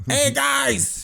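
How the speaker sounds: hammed-up Chicago repairman calling hello; mock Chicago accent greeting; loud Chicagoan. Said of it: "this loud fucking Chicagoan".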